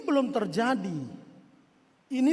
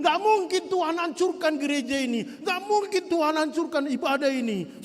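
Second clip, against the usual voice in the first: arrogant boast; 7 semitones higher, 4 dB louder than usual. Retold or imitated. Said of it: "Mereka menjadi sombong".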